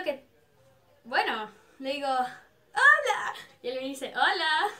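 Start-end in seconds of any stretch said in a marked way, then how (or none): 2.1-3.3 loud, high hola; re-enacted starstruck greeting; excited fan greeting. Excited fan greets her idol. 4.2-4.7 louder, slightly high hola; re-enacted upbeat hello; idol's reply. Idol answers the fan's hello.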